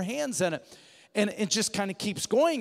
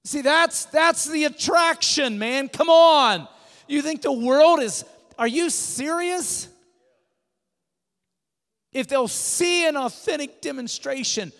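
louder, higher, fervent preacher's build; preaching at full force; 4 semitones higher, 7 dB louder than usual. Acted. Preacher to his congregation, fervent and loud. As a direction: drive it home to the congregation.

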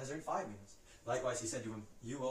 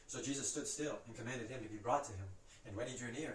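ordinary delivery, nothing marked

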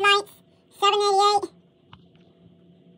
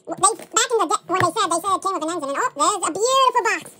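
normal talking voice

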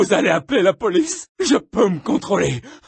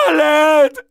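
crying for help; 5 semitones higher, 4 dB louder than usual. Acted. Calling for help.

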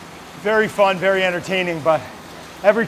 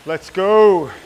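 louder, drawn-out rallying cry; pumped-up son imitation; 5 dB louder than usual. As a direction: mimic a pumped-up kid's catchphrase. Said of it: "As my son says".

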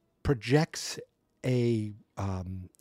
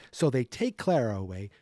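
normal talking voice

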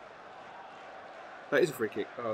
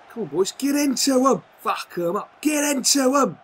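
loud, high shout to the team; yelling at his own team to attack, loud; urging shout; 10 semitones higher, 8 dB louder than usual. Real, not acted. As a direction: roar them forward.

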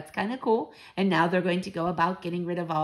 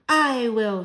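louder, higher declaration; volunteer with a mild cry; 5 semitones higher, 6 dB louder than usual. A narrator's reading. Grandmother volunteers to climb the trees, crying out. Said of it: "she cried".

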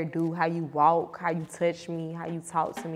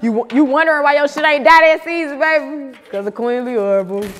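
gleeful boast; 8 semitones higher, 10 dB louder than usual. Real, not acted. Triumphant boast after laughing.

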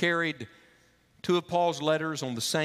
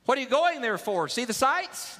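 high, eager voice of a traveller calling out; curious stranger; 10 semitones higher than usual. Acted. Traveller hailing two men on a dirt path, high and eager.

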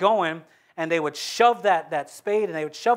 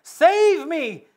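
high, loud, slow desperate plea; sinking man's panicked cry; 10 semitones higher, 8 dB louder, much slower than usual. A narrator's reading. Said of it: "he cried out".

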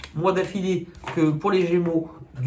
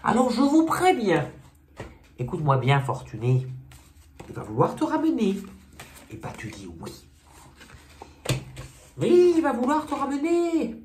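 comic sketch elder, warning; 5 semitones higher than usual. Acted. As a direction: warn Fortuné in escalating mock alarm. Plays sketch elder warning Fortuné.

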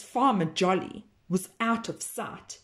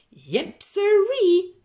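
cow's folksy yep; 10 semitones higher, 5 dB louder, slower than usual. Acted. Cow agreeing to the secret plan, folksy. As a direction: swoop up on sirree like a cheerful cow. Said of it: "nodded Cow".

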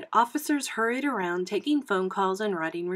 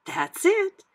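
high, loud burst of understanding; woman's realization; 12 semitones higher, 6 dB louder than usual. A narrator's reading. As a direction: say it high as the idea clicks. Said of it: "she said".

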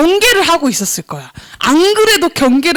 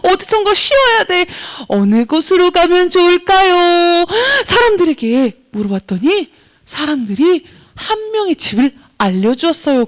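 usual speaking voice

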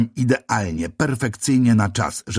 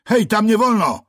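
high, loud warning call; 13 semitones higher, 4 dB louder than usual. A narrator's reading. Policeman calls out to stop the narrator, loud and high. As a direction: shout the warning across the crowd. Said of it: "zawołał na mnie".

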